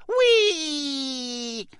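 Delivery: long, high "whee"; drawn-out poltergeist whoop; gleeful squeal. A narrator's reading. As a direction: squeal with impish delight. Poltergeist lobs water bombs.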